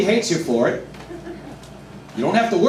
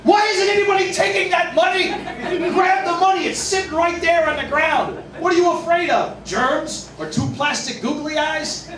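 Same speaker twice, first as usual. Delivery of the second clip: baffled inner rant; 8 semitones higher than usual. Retold or imitated. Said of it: "the only thing I could ever think is this".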